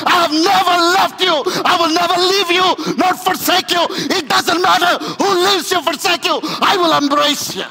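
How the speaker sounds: loud, high, shouted sermon climax; roaring preacher over music; preaching at full force. Acted. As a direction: preach the father's welcome with everything you have. Preacher climbs to a shouted peak.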